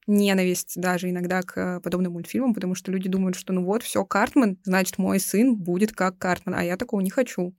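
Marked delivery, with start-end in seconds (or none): none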